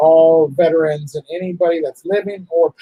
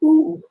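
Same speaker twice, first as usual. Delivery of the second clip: high crying whimper; 11 semitones higher than usual. Real, not acted. Breaking down crying.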